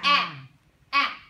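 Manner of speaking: Ah drawn as a clear short a sound; teacher modeling the Ah sound; short a demo. Shown as a demonstration. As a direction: say Ah like the a in cat. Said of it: "what sound does A make".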